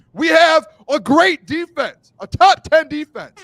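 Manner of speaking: loud, high, slow proclamation; booming excited boast; emphatic hype. Real, not acted. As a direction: punch out each word like a proud fan. Fan hyping team's defense.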